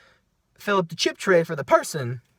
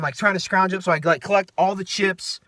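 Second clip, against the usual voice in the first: ordinary delivery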